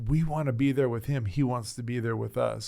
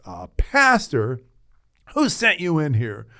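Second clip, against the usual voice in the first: louder, with a slow incredulous "A pastor?"; a patient bristling at an unwanted pastor; suspicious challenge; 5 semitones higher, 9 dB louder than usual. Retold or imitated. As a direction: demand who dared send him. Hostile patient questions pastor.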